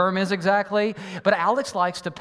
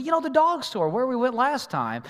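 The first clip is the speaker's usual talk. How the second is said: high, lightly voiced small boy; preacher imitating his young son; imitating his little son; 8 semitones higher than usual. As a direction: play the kid explaining patiently. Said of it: "He said".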